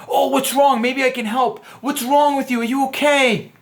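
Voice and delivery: high, fussing mock concern; mock Mister Wimpy. Wimpy everyman fussing over the girl, high and anxious. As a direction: play the eager nice guy who rushes in to help. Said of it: "or the everyman like".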